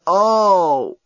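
high drawn-out pained cry; gasp of pain. A narrator's reading. Journalist misses footing stepping into gondola, pained gasp. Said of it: "The journalist gasped".

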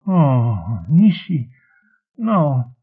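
high, pained pleading; distressed protest. A narrator's reading. Ryle resists Nishi's plan, distressed protest. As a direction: protest weakly, in separate high pieces.

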